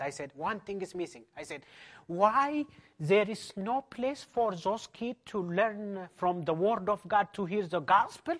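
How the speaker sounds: ordinary talk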